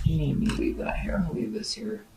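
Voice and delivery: soft whispered aside to herself; murmured whisper, barely voiced. Real, not acted. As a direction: breathe it out under the breath while laying the card down. Aside while setting down card.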